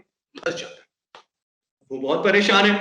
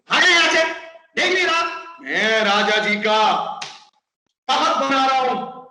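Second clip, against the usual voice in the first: angry carpenter; 4 semitones higher than usual. Acted. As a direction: drive the pest away, raising your voice. Carpenter angrily shoos the parrot.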